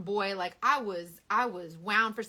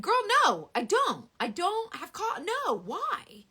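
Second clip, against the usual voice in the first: incredulous refusal; 9 semitones higher, 5 dB louder, slower than usual. Retold or imitated.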